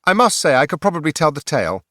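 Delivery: high, loud, eager sword voice; the sword Pointy butts in, keen to tell the tale; talking sword butting in. Acted. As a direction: interrupt with pompous eagerness. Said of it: "Pointy butted in".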